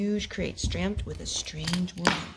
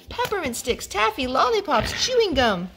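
high eager candy list; excited list; 8 semitones higher, 4 dB louder than usual. A narrator's reading. Mom reading the candy list to kids. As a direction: savor each treat with delight.

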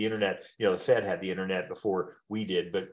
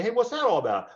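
acted phone call, upbeat agent; 11 semitones higher than usual. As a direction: play the breezy agent. Agent calls unsubscribed client.

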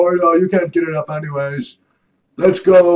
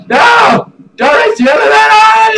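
much higher, louder panicked yell; panicked shout; 9 dB louder than usual. Real, not acted. Player yells at the fleeing man near the elevator, frantic shout. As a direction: scream it as he runs for the elevator.

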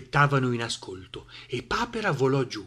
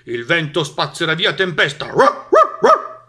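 loud, confident dog ending in sharp barks; barking dog; 4 semitones higher, 9 dB louder than usual. A narrator's reading. Dog reassures the animals about the wind, barking loudly. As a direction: proclaim it, then bark like a dog. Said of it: "abbaiò Cane".

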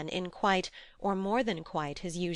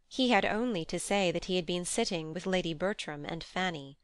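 ordinary delivery

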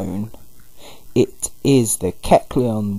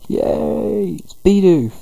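much higher, thin delighted squeal; squeaky cheer; 9 semitones higher, slower than usual. Retold or imitated. Greeting Bidoof with a high, gleeful squeal. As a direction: squeal it like an excited kid. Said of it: "and it's like".

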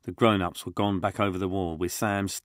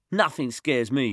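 put-on little tough kid; 6 semitones higher, 4 dB louder than usual. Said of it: "said Josh".

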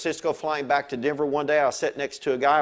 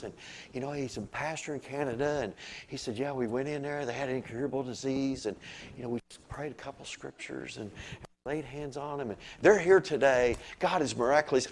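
soft mellow talk; 6 dB quieter than usual. Retold or imitated. Mimicking Canadian pastor's soft calm way of talking. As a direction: imitate a gentle pastor, barely above a hush. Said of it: "he kinda talks like this".

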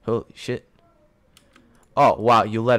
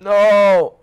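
loud, sharp no at the screen; real shout of dismay; 9 semitones higher, 13 dB louder than usual. Real, not acted. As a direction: yell it in dismay at a loss. Reacting to losing a point.

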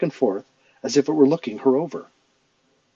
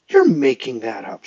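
accusing; 5 dB louder than usual. Acted. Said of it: "she accused him".

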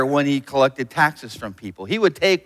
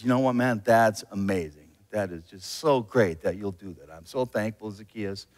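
lower, softer kindly Jesus; lightly voiced Jesus, softer; 7 semitones lower, 3 dB quieter than usual. Jesus thanks Zacchaeus after dinner, lower and softer. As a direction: thank him quietly, like a gentle friend.

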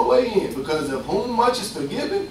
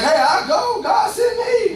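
mock eager volunteer; slower than usual. Preacher mocking churchgoers who volunteer too quickly, mock excited.

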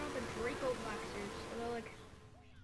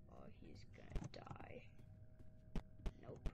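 hushed whisper; 9 dB quieter than usual. Real, not acted. Gamer watching teammate in danger, whispering under breath. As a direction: breathe it so nobody hears.

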